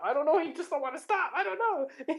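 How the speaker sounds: mock helpless. Father's mock helplessness, voiced high.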